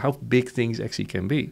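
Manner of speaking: ordinary talk, nothing marked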